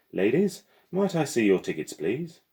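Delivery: bright, eager porter asking for tickets; mechanical porter voice. Acted.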